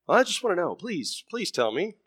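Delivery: put-on pleading child. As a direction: beg like a small son who wants it badly. Son begs his dad.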